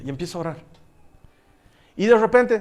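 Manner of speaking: ordinary talk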